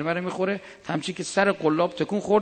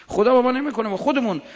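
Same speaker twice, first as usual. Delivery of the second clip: higher, louder preaching burst; emphatic raised voice; 7 semitones higher, 5 dB louder, faster than usual. Real, not acted. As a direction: push it up, correcting them firmly. Preacher raising voice.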